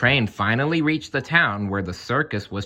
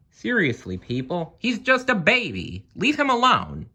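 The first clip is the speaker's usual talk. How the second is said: indignant; 9 semitones higher than usual. A narrator's reading. Narrator scolds the crowd.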